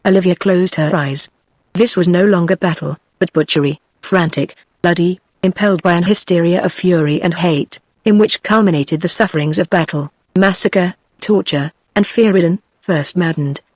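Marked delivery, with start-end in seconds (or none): none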